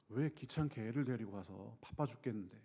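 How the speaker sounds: low, soft, put-upon mutter; irritated grumble. Retold or imitated. Disciples brushing off the parents, a low irritated mutter. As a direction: grumble as if the children are a nuisance.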